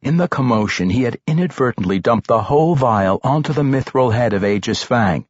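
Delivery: plain reading voice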